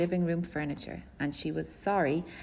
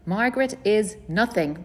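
angry outburst, louder and higher; storming; 5 semitones higher, 6 dB louder than usual. A narrator's reading. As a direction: snap it out in anger, louder. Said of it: "my father stormed".

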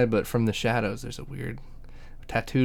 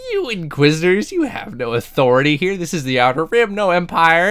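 raised-pitch, scolding mock protest; indignant space Karen; 5 semitones higher, 3 dB louder than usual. Lady telling off the Inquisitors, mock indignant. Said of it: "It's the space Karen".

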